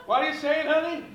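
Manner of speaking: high, loud mock-offended comeback; loud comic retort. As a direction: throw it back loudly at the audience. Comic retort to accent question.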